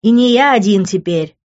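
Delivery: smug, high-pitched taunt; smirking. A narrator's reading. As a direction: rub it in with a smirk. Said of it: "ухмыльнулся Тео".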